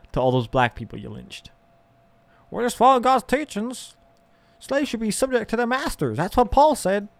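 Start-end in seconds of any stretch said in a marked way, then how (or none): 2.5-7.1 high mock pious voice; mock believer defending slavery, high and smug. Mocking Christians justifying slavery.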